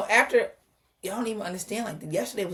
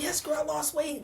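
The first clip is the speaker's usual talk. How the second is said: high, chatty retold boast; proud girlfriend-to-girlfriend chirp, softer; excited; 9 semitones higher, 5 dB quieter than usual. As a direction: recount it all excited, pitched up. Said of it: "I'm all excited".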